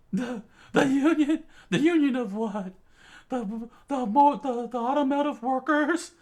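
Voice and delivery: high loud voice, startled and confused; startled guy. Acted. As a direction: jump and ramble in confusion. Chikara startled.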